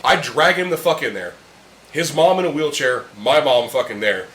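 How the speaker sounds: ordinary talk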